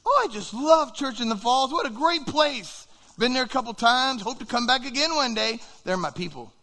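high, loud, enthusiastic rave; gushing praise. Acted. Famous visitor raving about the church, gushing enthusiasm.

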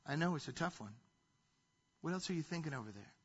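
soft, calm voice of God; God gently coaxing an angry child; gentle God. Retold or imitated. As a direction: answer patiently and kindly. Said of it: "And God says".

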